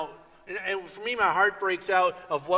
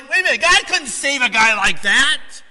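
louder, higher scoffing; loud disbelief; 7 semitones higher, 13 dB louder than usual. Retold or imitated. Church doubting Paul's conversion, loud scoffing voice.

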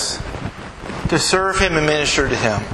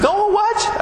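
alarmed question. Retold or imitated. Voicing the worried lady.

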